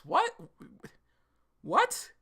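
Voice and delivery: genuinely thrown by the news; shocked what. Real, not acted. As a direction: blurt it out in disbelief. Reacting to a surprising reveal.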